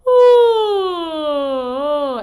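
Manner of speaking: high open yawn sigh, sliding down; yawning sound. Shown as a demonstration. Coach demonstrating a yawn to students, big sliding yawn. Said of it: "do some yawning sounds".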